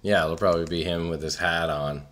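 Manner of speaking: put-on low, muttered Boston accent. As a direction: say it like a Boston local. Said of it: "Are you saying that in a Boston accent?".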